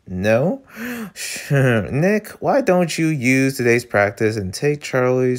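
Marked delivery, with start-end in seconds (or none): 0.7-1.5 a sudden breathy gasp of shock; quick shocked intake of breath; sharp gasp. Real, not acted. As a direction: gasp in surprise. Reacting to the show.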